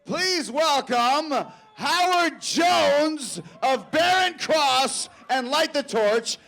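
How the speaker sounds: slow, building hype announcement to a shout; award announcer. Acted. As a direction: build the intro up to the big name. Announces final presenters.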